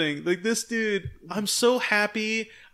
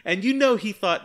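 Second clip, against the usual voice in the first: ordinary talk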